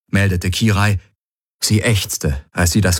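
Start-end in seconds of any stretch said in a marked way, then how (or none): none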